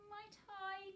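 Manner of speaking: high, quavering ask. A narrator's reading. Mary quavers a timid request to her guardian. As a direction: let the voice tremble up high. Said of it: "quavered Mary".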